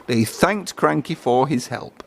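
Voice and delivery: plain reading voice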